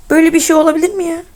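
rapid, exasperated appeal; indignant disbelief. Real, not acted. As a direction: fire off an incredulous question. Incredulous at game not jumping.

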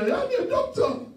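imitating a proud new doctor crowing over his results; bragging classmate. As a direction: shout it like a cocky student who just passed. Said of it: "he said".

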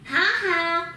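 a cheeky gloating laugh; the bogey laughs at the woodcutter. Acted. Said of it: "laughed the big bad bogey".

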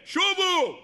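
high ringing call of return. Acted. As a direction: cry it out high. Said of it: "הוא צועק".

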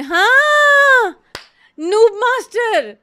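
high, loud, drawn-out shout of recognition; loud, high cry of delight; excited recognition. Real, not acted. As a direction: yell the name as it clicks. Recognising the Avengers line.

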